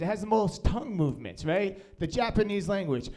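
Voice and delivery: ordinary delivery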